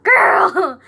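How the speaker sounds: loud, high-pitched mock protest; exasperated exclamation. Telling how grandma barges in, exasperated exclamation. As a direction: burst out, drawn-out and exasperated. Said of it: "and I'm like".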